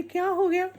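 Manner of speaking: surprised. Acted. Mother-in-law asks what happened.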